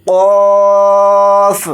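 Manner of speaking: قوف read thick, full-mouthed and drawn out; heavy deep q. Shown as a demonstration. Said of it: "اس طرح سے". (قوف کو موٹا پڑھیں)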